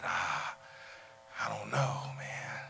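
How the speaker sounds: soft, hesitant murmur; half-whispered doubt; quietly unsure, almost whispered; hushed unsure reply. Retold or imitated. Friend's hesitant reply retold.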